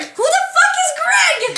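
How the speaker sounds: high, loud, slow laughing outburst; incredulous laughing shout. Real, not acted. Friend shouting in disbelief about Greg, laughing. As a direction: yell it in disbelief through laughter. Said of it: "Good question".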